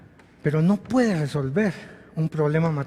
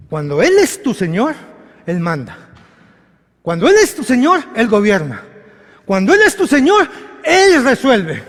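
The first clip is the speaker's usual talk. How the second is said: higher and louder, rising on each "Cuando Él es tu Señor"; full-force preaching; 7 semitones higher, 7 dB louder than usual. Acted. Preacher rallying the congregation, full force.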